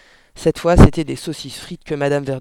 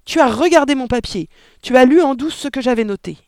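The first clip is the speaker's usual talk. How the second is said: loud, high-pitched accusation; furious yell; 8 semitones higher, 9 dB louder than usual. A narrator's reading. Father accusing daughter of peeking, yelling. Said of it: "hurla brusquement le père".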